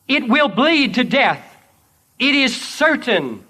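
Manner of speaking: loud, high preaching declaration; forceful proclamation. Acted. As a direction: proclaim a victory from the pulpit. Jesus's victory declared by preacher.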